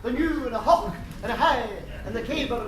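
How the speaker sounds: hammed-up Scottish brogue; mock Scots charm, laying it on; Scottish accent. [name] puts on mock Scottish.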